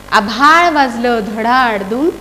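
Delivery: very slow, drawn-out sing-song chant; poem recitation. Shown as a demonstration. Teacher leading her pupils through the poem, slow sing-song recitation. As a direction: recite each word slowly for children to repeat.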